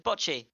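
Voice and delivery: "bocce" said "BOTCH-ee"; open-vowel bocce. Retold or imitated. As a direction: quote "bocce" back with short open o. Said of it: "You just said".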